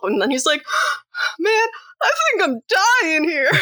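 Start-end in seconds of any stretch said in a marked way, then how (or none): 1.4-3.5 high, whiny wounded killer; hammed-up dying goofball, pitched way up; mock dying whine. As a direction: imitate a pitiful plea from the badly stabbed. Acting out dying Stu.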